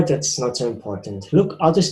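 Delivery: usual speaking voice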